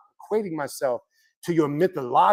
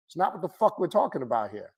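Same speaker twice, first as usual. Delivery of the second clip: ordinary delivery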